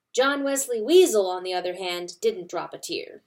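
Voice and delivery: plain reading voice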